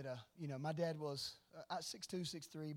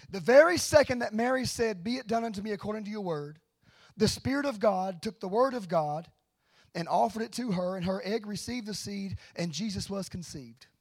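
very loud, raised-pitch sermon proclamation; full-force preaching; 3 semitones higher, 14 dB louder than usual. Real, not acted. Preacher proclaiming the conception of Jesus, forceful preaching. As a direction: thunder it out to the congregation.

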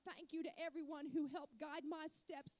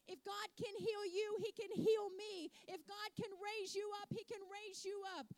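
rising, louder preacher's build; building sermon climax, higher and louder; preaching at full force; 4 semitones higher, 4 dB louder than usual. Acted. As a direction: drive it home to the congregation.

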